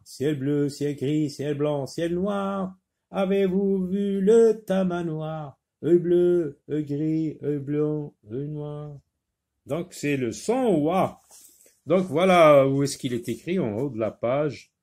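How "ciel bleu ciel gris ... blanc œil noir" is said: rhyme read aloud. Shown as a demonstration. Teacher reads phonics rhyme.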